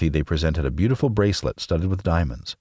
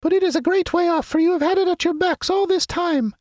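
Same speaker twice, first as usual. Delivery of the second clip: very high squeaky mouse queen; squeaky little voice. A narrator's reading. Queen of the Mice greeting her friends, squeaky little voice. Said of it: "in her squeaky little voice".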